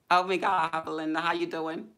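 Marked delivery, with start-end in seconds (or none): none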